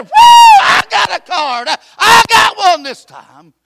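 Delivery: slow, high, shouted cry of joy; joyful shout. Retold or imitated. Preacher reliving his shout over a card in the post office, slow and loud. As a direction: shout it out like you just got the best news. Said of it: "I shouted in the post office".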